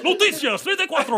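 hammed-up Spanish TV announcer; high laughing ad announcer. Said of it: "yelling commercials at each other".

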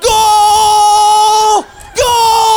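long, high, loud cry of goal; triumphant yell. Retold or imitated. Dad celebrating son's goal, triumphant scream. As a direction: roar it like a stadium.

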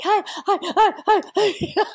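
high, rapid-fire whale voice; imitated whale squealing hellos; eager whale greeting. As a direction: pester like an overexcited, affectionate whale. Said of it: "he's got me on his head and he's like".

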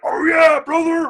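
loud high wrestling yell; wrestler shout. Retold or imitated. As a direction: bellow it like a pro wrestler. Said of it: "Oh, he was yelling a lot".

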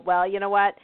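ordinary delivery

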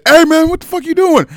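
high, loud yell at an intruder; alarmed shout. Retold or imitated. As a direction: re-enact shouting someone off. Retold shout at shower intruder.

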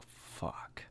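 whispered curse under the breath; scared whisper. Retold or imitated. Plane shakes harder, scared.

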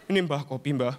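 low, deferential offering voice; placating helper. Acted. Helper hands coffee to the possessed elder, placating.

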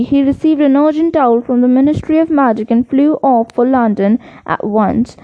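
usual speaking voice

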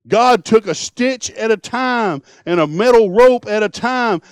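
fiery revival preaching; full-force preaching. Acted. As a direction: thunder it out to the pews.

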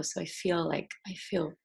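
usual speaking voice

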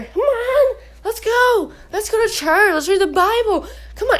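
high, whiny mock pleading; pleading coaxing. Partner dragging the other to church, hammed-up pleading. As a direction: coax a reluctant partner along.

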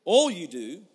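slow, drawn-out quotation; solemn scripture quote. Shown as a demonstration. Quoting Solomon to the church, slow solemn reading. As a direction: lay the verse out slowly. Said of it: "Solomon says it like this".

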